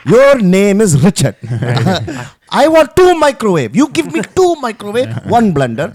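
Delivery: loud, high hammed-up Indian accent; mock Indian customer demanding a refund. Comic plays an angry Indian customer, hammed-up Indian accent. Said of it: "use that accent".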